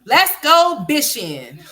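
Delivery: slow, high, loud "bishing" with a B for the F; imitating son. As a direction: stretch out "let's go bishing" in a child's voice. Repeats son's mispronunciation.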